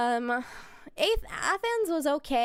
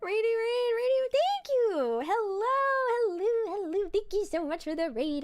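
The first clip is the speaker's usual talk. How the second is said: cutesy raid welcome; 7 semitones higher than usual. Pretend. Streamer welcoming a second raid, silly cutesy high voice. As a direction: gush hello in a silly high voice.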